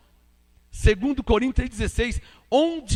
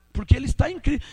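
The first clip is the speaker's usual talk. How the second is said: ordinary talk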